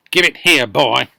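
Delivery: put-on loud furious old woman; yelling grandma. Grandma demanding the cup from George, yelling. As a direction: yell the demand. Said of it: "yelled Grandma".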